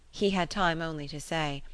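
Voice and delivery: plain reading voice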